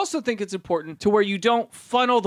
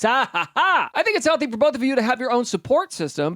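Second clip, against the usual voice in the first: usual speaking voice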